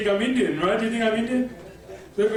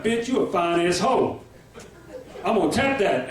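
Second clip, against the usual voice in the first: put-on Texan friend's accent.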